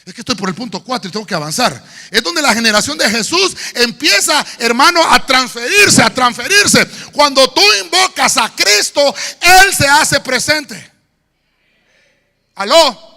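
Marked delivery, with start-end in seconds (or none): 2.1-13.1 loud sung-out preaching; full-force preaching. Acted. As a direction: preach it with music. (predicación a todo pulmón)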